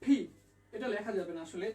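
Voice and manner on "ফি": "ফি" as an aspirated p, puff of air; correct letter P. Shown as a demonstration. Teacher giving a sound that can't be written, correct P. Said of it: "পি এর উচ্চারণটা হবে".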